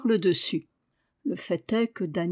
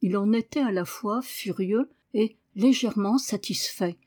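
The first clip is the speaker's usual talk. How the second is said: plain reading voice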